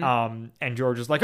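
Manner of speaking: ordinary talk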